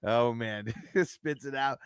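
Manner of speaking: ordinary talk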